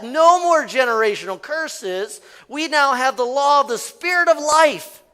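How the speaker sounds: ordinary delivery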